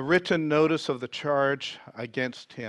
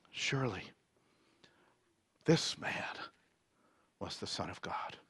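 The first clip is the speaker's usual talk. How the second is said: hushed awe; 6 semitones lower, 12 dB quieter than usual. A narrator's reading. Centurion realizes who died on the cross, hushed awe. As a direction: breathe it out softly, word by word. Centurion's awed words at the death.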